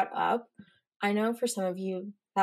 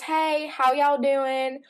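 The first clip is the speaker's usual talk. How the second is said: slow, louder drawled greeting from a stranger; lightly voiced random man, higher and stretched out; imitating Marcus; 4 semitones higher, 6 dB louder, much slower than usual. Stranger greeting the girls outside, slow loud imitation. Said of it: "I'm gonna imitate him".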